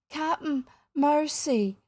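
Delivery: high, trembling plea; whimpering. A narrator's reading. Starkey begs his captain all of a tremble, whimpering. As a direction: whimper for mercy. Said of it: "Starkey whimpered".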